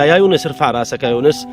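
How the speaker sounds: ordinary delivery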